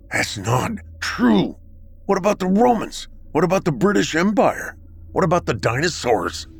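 breathless, rising, rapid questions; panting. Acted. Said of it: "I panted".

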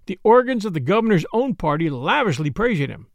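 usual speaking voice

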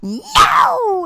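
high, loud mock tantrum yell; toddler screaming no. Mom imitates her daughter's tantrum at dinner. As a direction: shriek it like a furious toddler. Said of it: "today was like".

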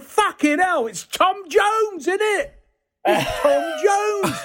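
very high, drawn-out star-struck disbelief; imitating astonished driver. Lorry driver mistakes an actor for a singer, mock amazement. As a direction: stretch the name out in high-pitched mock amazement.

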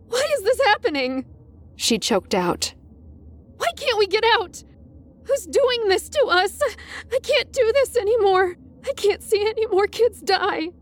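high, cracking sobbing voice; frightened teacher breaking down in the hall; weeping teacher. Acted. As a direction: choke the words out through tears. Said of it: "She was weeping, and her voice cracked".

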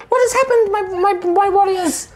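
very high, small princess voice, bewildered; tiny fairy princess. Acted. GM voicing the fairy princess to her warriors, very high and confused. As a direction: squeak it out like a tiny lost princess.

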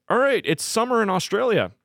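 high, eager "let's go"; excited. Retold or imitated. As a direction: pump it up like a hyped DJ. Mimicking DJ touring energy.

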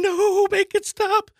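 high, slow, whiny plea; begging for it all to end; a squeaky, drawn-out mock plea; pleading despair.

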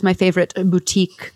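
put-on a playful French accent on boutique, said as boo-TEEK; mock French accent. Teasing host, fashion description ends in mock French accent. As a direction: put on a light French accent for the shop name. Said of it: "all that's missing was the accent".

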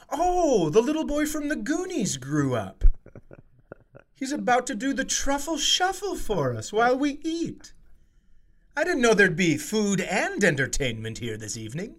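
high, put-on snooty onlooker voice; mocking diners. Restaurant diners mocking him shirtless, high and snide. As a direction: sneer like a gossiping restaurant patron. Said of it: "everyone there goes".

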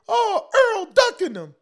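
very high, loud, drawn-out fan mimicry; exaggerated squeal of accusation; mock fan outcry. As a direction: wail the accusation in mockery of fans. Mimics fans' biased outcry.

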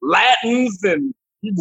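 usual speaking voice

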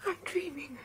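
awed little whisper. Acted. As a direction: whisper it in disbelief. Alone at night, hearing a voice.

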